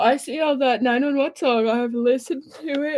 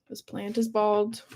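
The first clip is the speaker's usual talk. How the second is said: ordinary talk, nothing marked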